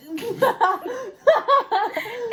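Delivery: a high burst of laughter. Real, not acted. Class laughing at her.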